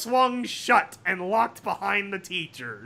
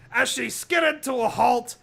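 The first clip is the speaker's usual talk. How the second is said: plain reading voice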